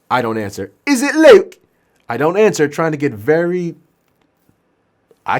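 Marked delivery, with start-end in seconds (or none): 0.9-1.6 very high, loud question; insistent patient. Acted. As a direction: call out the guess as the patient. Patient guesses the helper's name.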